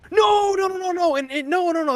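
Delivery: imitating a flustered friend, very high-pitched; high, hurried string of protests; flustered denial. Friend from England rushing to take back an insult, flustered.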